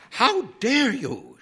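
high, loud, drawn-out indignation; indignant high librarian voice; outraged librarian. A narrator's reading. Outraged librarian orders horse out.

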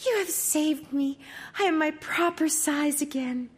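put-on high, joyful young princess; a rescued princess, thrilled. Princess speaks.

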